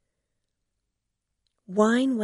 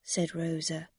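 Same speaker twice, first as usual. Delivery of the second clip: ordinary delivery, nothing marked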